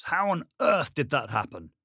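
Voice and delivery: high, loud disbelieving question; incredulous. Acted. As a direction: demand it in disbelief. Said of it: "Denny was incredulous".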